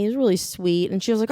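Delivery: ordinary talk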